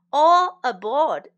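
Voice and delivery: slow, loud boarding call; a ferryman's loud, drawn-out call. Shown as a demonstration. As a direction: call everyone onto the boat. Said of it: "teacher calls the phrase".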